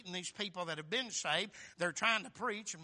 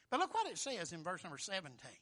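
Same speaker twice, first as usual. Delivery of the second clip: usual speaking voice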